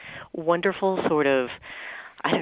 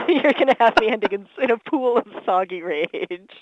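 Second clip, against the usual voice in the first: giggling out the words, a bit louder; laughing through words; 6 semitones higher, 5 dB louder than usual. Real, not acted. Laughing along with the host at the soggy rage joke. Joking about ending in rage.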